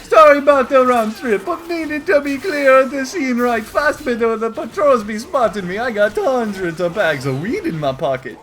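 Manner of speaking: loud, high stoner voice; goofy high voice. Acted. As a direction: ramble like a dopey goof. New character arrives.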